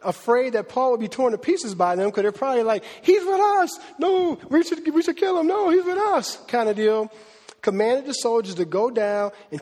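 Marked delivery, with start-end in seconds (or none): 3.0-6.4 high, urgent mock tug-of-war; heated quarrel. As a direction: squabble over him, pitched way up. Acting out two factions fighting over Paul.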